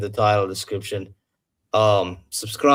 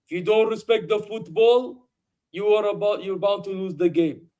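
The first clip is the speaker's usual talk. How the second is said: raised, preachy coach lecture; quoting the manager; 8 semitones higher than usual. Retold or imitated. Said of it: "our manager after the game was like".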